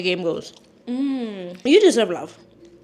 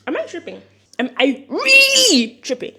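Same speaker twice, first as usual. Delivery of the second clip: louder, slowed mock disbelief; indignant; 6 semitones higher, 7 dB louder than usual. Real, not acted. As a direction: drag it out, outraged. Indignant at viewers' dirty minds.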